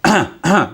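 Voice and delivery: hard, loud throat clear; harsh "ahem" without words. Shown as a demonstration. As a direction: demonstrate a forceful double throat clear. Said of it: "If I throat clear like".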